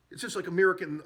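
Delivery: ordinary delivery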